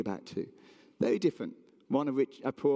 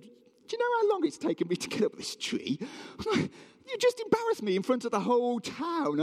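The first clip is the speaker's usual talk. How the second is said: high-pitched, put-out complaint; hammed-up protest, pitch shooting up; mock indignant; 12 semitones higher than usual. Zacchaeus complaining to Jesus from the tree, mock indignant.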